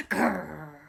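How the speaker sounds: low loud growl; dog snarl. Acted. Big dog snarls at Peter, low growl. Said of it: "snarled the dog".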